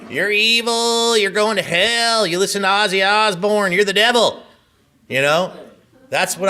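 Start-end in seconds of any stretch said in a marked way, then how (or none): 0.1-4.4 hammed-up fire-and-brimstone scolding, high and loud; mock accusers. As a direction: parody the people who damned him, shrill and preachy. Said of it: "All my life I've been told".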